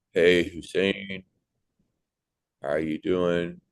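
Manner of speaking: slow, lazy laid-back greeting; too casual. Retold or imitated. Imagined doctor greeting a patient, slow and overly casual. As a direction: drawl it out like you don't care. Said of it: "the doctor was talking to me like".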